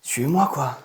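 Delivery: panting between words; breathless after hard work; out of breath. Real, not acted. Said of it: "mais je suis essoufflé".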